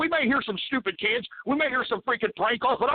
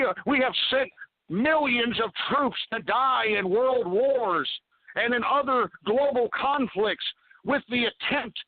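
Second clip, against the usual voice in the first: ordinary talk, nothing marked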